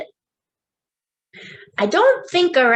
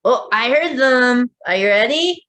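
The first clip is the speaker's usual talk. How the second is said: ordinary delivery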